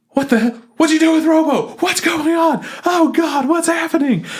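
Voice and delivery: high, panicked squeal. Retold or imitated. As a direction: freak out like a cartoon girl in shock. Baka panicking over Robo.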